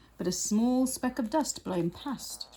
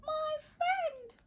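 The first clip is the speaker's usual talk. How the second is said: much higher, tiny voice; tiny speck voice; 6 dB quieter than usual. Acted. The tiny Who calling to Horton. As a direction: squeak up from the speck.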